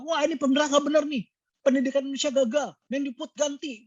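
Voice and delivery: high-pitched outraged ranting; angry returnee blaming corruption and the government; furious rant. Acted. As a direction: rant at the thieving villagers, pitch way up. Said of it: "dia langsung marah banget".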